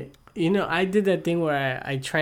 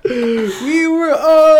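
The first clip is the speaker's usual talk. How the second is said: slow, high, loud hammed British accent; hammed-up British Ross line; mock British Ross; 10 semitones higher, 9 dB louder, much slower than usual. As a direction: drag it out like a posh Ross.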